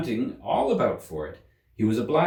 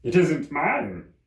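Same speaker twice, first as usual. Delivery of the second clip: the Hatter; 7 semitones higher, much slower than usual. A narrator's reading. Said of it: "said the Hatter".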